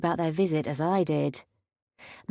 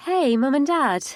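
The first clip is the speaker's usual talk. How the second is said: higher, louder fake cheerful greeting; forced enthusiasm; 7 semitones higher, 5 dB louder than usual. A narrator's reading. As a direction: sound happy, but it's forced. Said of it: "I tried to inject enthusiasm into my tone".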